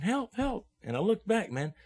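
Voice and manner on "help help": high-pitched mock yell without volume; Hugh yelling for help. Storyteller replays his friend's cries, a contained high yell. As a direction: imitate a distant cry for help, high. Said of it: "I hear Hugh yelling".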